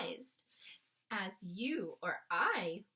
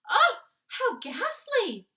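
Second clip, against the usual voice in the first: slow, high and loud horrified outcry; crying out in horror at the news; 12 semitones higher, 14 dB louder, slower than usual. Acted. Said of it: "the famous voice cried out".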